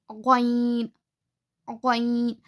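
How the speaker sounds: ग़ैन with a voiced gargled gh from the upper throat; ग़ैन: throat gh, long ai; Arabic ghain. Shown as a demonstration. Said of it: "हलक के ऊपर वाले हिस्से से ग़ैन अदा होता".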